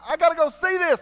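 high, loud, eager outburst; excited exclamation. Retold or imitated. Townsperson hears of the miracle, excited exclamation. Townspeople hear she is alive.

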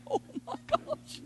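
hushed, whispered onlooker reaction; stunned bystander under the breath; shocked whisper. Acted. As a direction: whisper it aside, aghast.

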